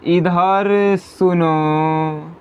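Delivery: इधर सुनो with सुनो stretched long, like calling someone from afar; drawn-out call. Shown as a demonstration. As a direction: call out इधर सुनो, holding the last vowel of सुनो. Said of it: "प्लुत स्वर का उदाहरण क्या है?".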